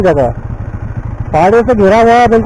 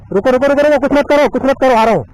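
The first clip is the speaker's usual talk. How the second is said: higher-pitched, insistent warning; urgent calling out; 4 semitones higher than usual. Real, not acted. Vlogger calling to a stuck rider while coming to help, urgent calling out. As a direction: call out urgently to halt someone.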